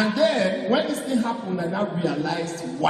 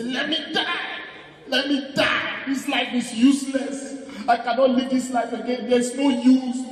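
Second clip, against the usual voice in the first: hammed-up grieving wail, pitched high and louder; mock wailing; 8 semitones higher, 6 dB louder than usual. At the graveside, wailing in mock despair. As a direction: beg to die like a mourner overacting at the graveside. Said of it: "I say".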